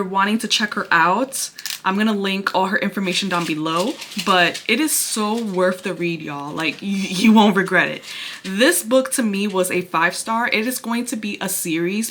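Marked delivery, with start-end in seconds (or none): none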